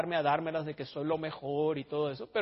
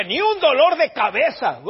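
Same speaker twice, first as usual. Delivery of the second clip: bold challenge, loud, high and drawn out; Arnoldo Gutiérrez; 7 semitones higher, 11 dB louder than usual. Retold or imitated. Imitating the admired preacher challenging listeners with the Word, loud and emphatic. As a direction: thunder it out like a defiant preacher. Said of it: "te retaba con la palabra de Dios".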